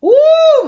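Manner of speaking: high loud yelp; sudden loud ooh of pain. Real, not acted.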